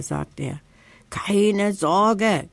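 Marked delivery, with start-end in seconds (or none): 1.1-2.5 a light croak, rasped out. Acted. Socke reassures the nervous badger with a croak. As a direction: croak it like a scratchy bird. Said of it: "krächzt Socke".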